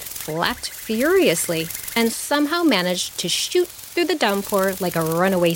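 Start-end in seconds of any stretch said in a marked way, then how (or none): none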